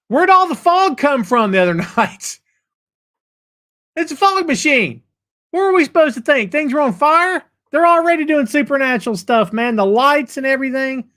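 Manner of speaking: high, incredulous protest; mock indignant. Host defends fans' belief in spooky stuff, mock indignant. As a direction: argue it back in high-pitched disbelief.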